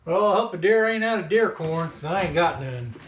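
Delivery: ordinary talk, nothing marked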